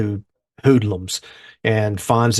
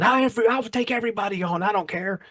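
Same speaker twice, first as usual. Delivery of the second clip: yelling challenge; 10 semitones higher than usual. Retold or imitated. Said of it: "he starts yelling".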